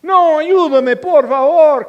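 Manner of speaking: high, loud hammed-up plea for help; mock pleading. Needy people begging pastors, mock loud pleading. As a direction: beg theatrically. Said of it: "personas que no quieren nada".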